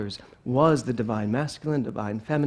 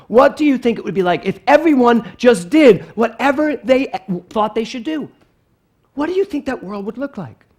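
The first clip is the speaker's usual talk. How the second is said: raised-voice, higher-pitched challenge; lecturing mother; 7 semitones higher, 8 dB louder than usual. Retold or imitated. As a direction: imitate a mom demanding her kid think it through.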